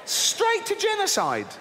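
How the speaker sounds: high, squealing outrage with laughter; outraged disbelief. Real, not acted. Mocking God's flood plan, in high-pitched outrage. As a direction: rant at God in shrill disbelief.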